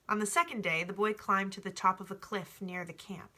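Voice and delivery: ordinary delivery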